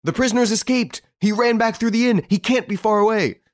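high, loud breathless alarm; greatly excited. A narrator's reading. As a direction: raise the alarm at a run. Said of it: "greatly excited".

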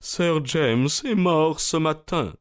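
high, slow, grave butler breaking the news; solemn butler. A narrator's reading. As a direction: deliver the death like a solemn servant. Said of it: "s'écria-t-il d'une voix solennelle".

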